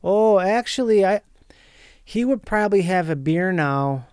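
a lightly voiced out-of-work welder from Wisconsin. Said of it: "this character I was trying out".

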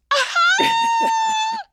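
high shrieking burst of laughter. Real, not acted. Bursts out laughing at bed joke.

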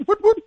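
high, loud siren whoop; mock siren. Host playing cop pulling someone over, mock siren.

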